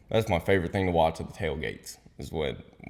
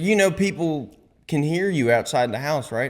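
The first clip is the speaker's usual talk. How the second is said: high-pitched pointed question; retold incredulous warning; 8 semitones higher than usual. Buddy warning he is heard outside, incredulous. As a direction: imitate an exasperated buddy spelling it out.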